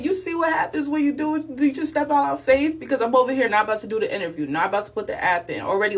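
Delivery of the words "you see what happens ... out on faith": much higher, eager exclaim; overjoyed, preaching a little; excited. Retold or imitated. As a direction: lift it up like a testimony. Excited rhetorical praise.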